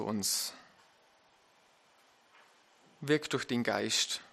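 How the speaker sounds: hushed closing prayer, then a soft amen. Acted. Closing prayer after reading.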